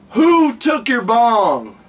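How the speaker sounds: ordinary delivery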